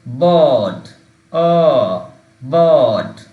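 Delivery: বার্ড said loud with a long आ and the mouth not opened; loud model word. Shown as a demonstration. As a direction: model বার্ড loudly without opening the mouth. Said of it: "আমি কিন্তু হা করতেছি না".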